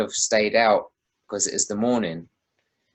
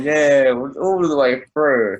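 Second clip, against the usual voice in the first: loud, high yeah; drunken whoop; 5 semitones higher, 6 dB louder, much slower than usual. Retold or imitated. Mimicking himself whooping drunk through the video. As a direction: belt out a drunk party yeah.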